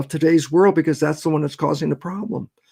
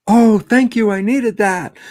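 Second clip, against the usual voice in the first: high, loud "oh, thank you"; much higher and louder thankful exclamation; mock grateful; 9 semitones higher, 5 dB louder, slower than usual. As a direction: burst out in sudden gratitude. Retold grateful realization.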